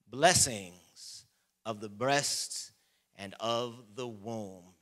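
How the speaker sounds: slow measured reading of Genesis; solemn scripture reading. Acted. Preacher reading Genesis to the congregation, slow and solemn. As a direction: lay out each blessing slowly.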